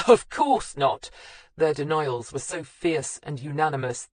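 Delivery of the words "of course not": slow, louder and higher denial; over-emphatic, unconvincing denial. Acted. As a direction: lean hard on every word. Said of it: "said emphatically".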